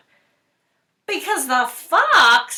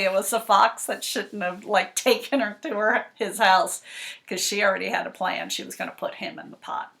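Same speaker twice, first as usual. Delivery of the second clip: usual speaking voice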